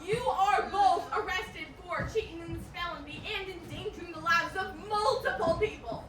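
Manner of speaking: booming, slow announcement, chuckling; laughing sheriff booming the charges; loud slow arrest. Acted.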